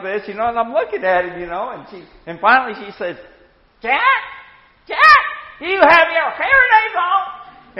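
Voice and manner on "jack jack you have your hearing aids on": high, raised call to a deaf husband; wife calling out to her deaf husband, loudly. Retold or imitated. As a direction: call his name across the room.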